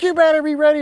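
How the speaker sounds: high, loud shouted warning; retold fired-up holler; screaming. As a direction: yell it in your son's face, acting it out. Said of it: "And I'm screaming out".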